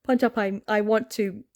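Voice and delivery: ordinary talk, nothing marked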